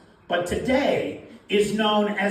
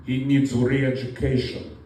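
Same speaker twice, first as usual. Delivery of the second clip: stern agent; 8 semitones lower than usual. Acted. FSB agent justifies the arrest.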